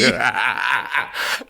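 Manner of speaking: a breathy, crying laugh. Real, not acted. Co-host teased about hiding keys, crying laugh. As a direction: crack up helplessly.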